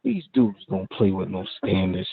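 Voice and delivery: ordinary talk, nothing marked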